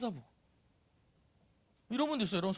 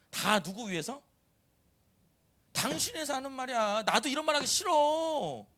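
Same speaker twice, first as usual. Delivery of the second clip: insistent nagging, climbing high and drawn out at the end; nagging wife and mother; 6 semitones higher than usual. Acted. Wife nagging husband and kids that it's for their sake, exasperated. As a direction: whine it out, stretching the last words in a raised pitch. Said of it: "너무 목소리 리얼하지 않아요".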